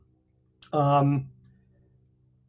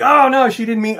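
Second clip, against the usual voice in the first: loud, high mock realisation; dawning dismay; 7 semitones higher, 8 dB louder than usual. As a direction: act out suddenly catching on, louder and higher. Retold dawning realisation of the insult.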